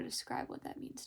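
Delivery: quick unvoiced whisper; whispered, overwhelmed aside. Real, not acted. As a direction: rush it out under your breath. Emotional moment reading her journal.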